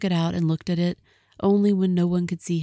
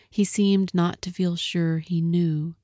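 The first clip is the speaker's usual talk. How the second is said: usual speaking voice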